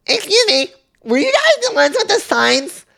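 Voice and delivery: very high, loud squeaky caricature; mocking lady voice. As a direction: imitate an intrusive lady in piercing falsetto.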